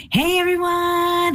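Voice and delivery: high, slow, beaming hello to the shop; cheery greeting. Pretend. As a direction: sing out a jolly welcome like pulling up with music. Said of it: "I'm gonna just pretend".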